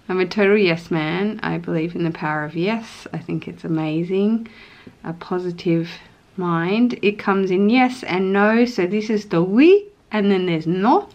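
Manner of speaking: ordinary delivery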